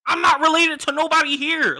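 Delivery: loud, high exasperated protest; exasperated outburst. Real, not acted. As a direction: tells the audience he's related to nobody, exasperated. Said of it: "exasperated at the party".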